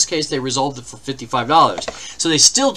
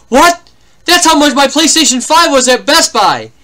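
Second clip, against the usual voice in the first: imitating a stunned caller, much higher and louder; shocked outburst; 9 semitones higher, 9 dB louder than usual. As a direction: blurt it out like he can't believe it. Retold shocked caller yelling.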